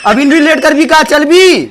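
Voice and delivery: high-pitched exasperated demand; impatient shouting. Acted. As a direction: snap at the wife to get moving. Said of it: "तू तो चिल्ला चिल्ला". (झुंझला के चिल्लाइल)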